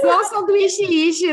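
slow, high, laughing mock pronunciation; laughing mimicry. Joking with friends about a silly accent, laughing. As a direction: drag the words out while cracking up. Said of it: "mocking a funny pronunciation".

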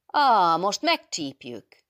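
loud, high war cry, drawn out; gloating shout, slowing at the end; howling, mocking triumph. A narrator's reading. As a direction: crow it in gleeful battle triumph. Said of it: "kiáltott Adél üvöltő, gúnyos harci diadallal".

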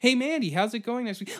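high, chirpy friend voice; mock teen girl chatter; bright girly greeting. Greeting her friend Mandy in a high girly voice.

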